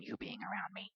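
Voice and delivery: whispered jab; whispered aside. Acted. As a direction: breathe it out as a dig. Unvoiced aside.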